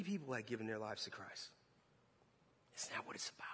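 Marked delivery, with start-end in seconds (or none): 2.8-3.5 hushed, breath-only question; whispered. Retold or imitated. Preacher after seeing lives given to Christ, whispering. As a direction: whisper it as a humbling realisation.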